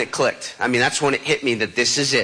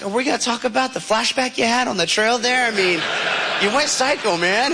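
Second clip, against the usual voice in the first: incredulous; 7 semitones higher than usual. Retold or imitated. Questioning the SEAL's outburst.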